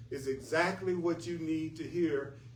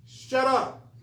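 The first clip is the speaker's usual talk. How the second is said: high, loud, abrupt order; mock command; 9 semitones higher, 7 dB louder than usual. Teacher telling the angry self to stop, sharp mock command. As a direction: blurt it out as a punchline.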